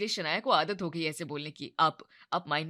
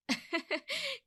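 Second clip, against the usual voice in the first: high amused laugh; 4 dB quieter than usual. Acted. Shanaya laughs at Gaurav.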